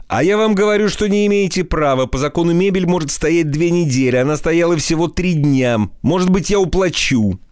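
a panicky, high-pitched protesting flatterer; high protesting pleader. Acted. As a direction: chase him, protesting in a high voice. Изнурёнков protests, chasing Ostap.

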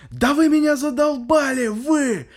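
put-on high, loud furious old man; yelling. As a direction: yell across the stairwell. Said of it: "начинает просто орать на весь подъезд".

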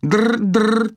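high insistent trilled ringing; demanding phone ring. Pretend. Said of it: "требовательно повторила Ирис".

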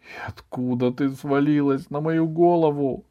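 put-on high, wistful boy's lament; anguished. A narrator's reading. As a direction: lament it inwardly with weary longing. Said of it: "с тоской подумал Иван".

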